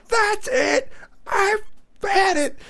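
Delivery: high-pitched re-enacted shout, word by word; fed-up yell. Dad losing it at his kids in a store, re-enacted shouting. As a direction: blow up in punched bursts, ending on a long STOP.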